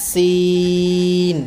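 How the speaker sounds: Seen held very long on a level tone; three-alif stretch. Shown as a demonstration. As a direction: draw out the vowel of সিন for three counts. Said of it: "তিন আলিফ".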